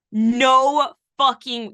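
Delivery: loud, drawn-out reenacted amazement; reliving a big shock, slow and loud; stunned disbelief. Acting out stunned reaction to album.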